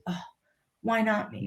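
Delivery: soft, deflated self-pity; victim's mentality. Retold or imitated. Loser lying awake in the hotel room, self-pitying. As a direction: sigh it out softly, feeling sorry for yourself. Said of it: "turned into a victim's mentality of".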